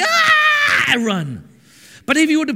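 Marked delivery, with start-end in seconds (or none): 0.0-0.9 very high, loud scream of panic; mock frightened scream. Preacher imitating Christians running from the devil, mock scream. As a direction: shriek like you're about to flee. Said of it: "And Christians like".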